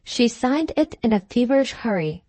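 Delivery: plain reading voice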